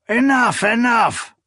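high loud booming shout. A narrator's reading. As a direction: roar it to stop the fight. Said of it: "a hoarse voice boomed".

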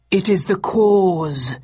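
slow, crackling machine voice box; a crude talking dummy, slowed down; crackly mannequin voice. Acted. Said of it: "crude voice box crackled".

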